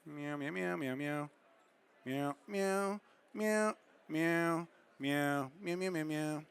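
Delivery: soft, repeated mock meows; cat meows. Idly filling the pause during the shoot, soft playful meowing. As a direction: meow softly over and over like a cat.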